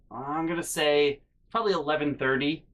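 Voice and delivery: usual speaking voice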